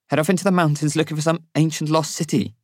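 high, questioning voice of the companion; incredulous companion. A narrator's reading. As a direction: toss it out as an absurd suggestion. Companion's sceptical retort, voiced by narrator.

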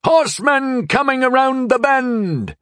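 high, drawn-out cry from the watchtower; sustained high lookout's call. A narrator's reading. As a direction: call the alarm across a distance, slowly. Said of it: "The watchtower called out".

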